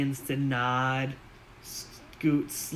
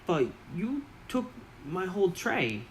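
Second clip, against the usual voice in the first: higher, indignant goblin voice; a raised goblin whine about the tray; high goblin protest; 5 semitones higher, 4 dB quieter than usual. Acted. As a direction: goblin squeak, put out.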